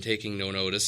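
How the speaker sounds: ordinary delivery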